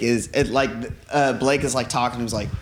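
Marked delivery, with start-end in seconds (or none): none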